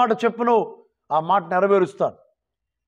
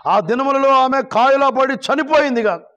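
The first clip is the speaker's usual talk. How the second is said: high, measured Bible reading; scripture proclamation; 5 semitones higher, 3 dB louder than usual. Real, not acted. Preacher reads Tabitha's story to the congregation, high proclaiming voice. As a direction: project each verse in a sustained preacher's voice.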